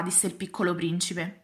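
ordinary delivery, nothing marked

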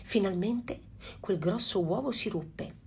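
usual speaking voice

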